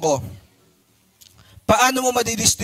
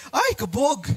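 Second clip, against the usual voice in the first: higher, campy "Ay, kabog!"; 5 semitones higher, slower than usual. Retold or imitated. Teacher mimics a flamboyant speaker for the class, campy and high. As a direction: toss it off with camp flair.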